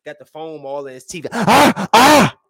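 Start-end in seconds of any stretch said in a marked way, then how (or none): 1.3-2.4 loud imitated rapper bark. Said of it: "he probably be in the booth like".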